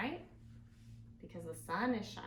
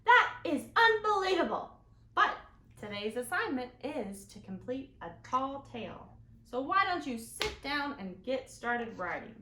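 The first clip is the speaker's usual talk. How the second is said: higher, louder stern teacher; 5 semitones higher, 8 dB louder than usual. Acted. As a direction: call out the boy's full name. Teacher character speaks.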